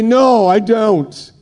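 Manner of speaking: high, drawn-out confession; emphatic, stretched-out mock reply; acted admission. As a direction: admit it slowly, pitched well up. Acts out own answer to God.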